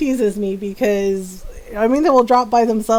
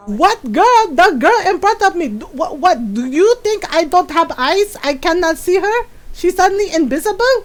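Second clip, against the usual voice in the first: scolding mom; 6 semitones higher, 3 dB louder than usual. Retold or imitated. Mom scolding son about girl.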